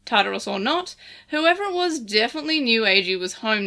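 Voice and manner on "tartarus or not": ordinary delivery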